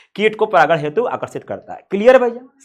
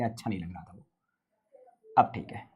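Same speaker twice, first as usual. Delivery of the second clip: quiet aside; 12 semitones lower, 16 dB quieter, faster than usual. Real, not acted. Muttering while redrawing.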